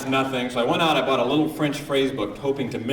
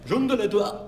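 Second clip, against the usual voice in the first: much higher mock-French gibberish; hammed-up French babble; 12 semitones higher, slower than usual. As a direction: make French sound like nonsense. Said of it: "But French is like".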